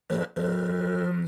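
low continuous cough; throat bass. Shown as a demonstration. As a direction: hold a low rasping cough. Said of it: "So it sounds like this".

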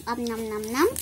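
playful pretend munching; nom nom chomping. Pretend eating toy food.

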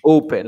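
"Open" loud on the O, then falling; stress on first syllable. Shown as a demonstration. Teacher confirming the answer, "Open" stressed at the start. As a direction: hit the start of "Open" firmly. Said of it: "La fuerza de voz está al inicio".

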